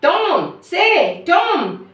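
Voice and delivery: high, insistent calls of the name; calling to rouse. Acted. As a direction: call out to a groaning brother. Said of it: "Sid said".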